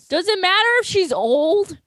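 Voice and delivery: lightly voiced Goku asking; imitating Goku. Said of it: "he goes".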